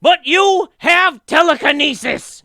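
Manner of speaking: high, very loud, drawn-out squawk; Gilbert Gottfried. Acted. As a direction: abrasive shouted announcement in a screechy put-on voice. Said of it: "like Gilbert Gottfried being like".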